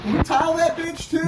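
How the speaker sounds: high, loud eager ask; chirpy workers volunteering; eager mock question. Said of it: "And they're like".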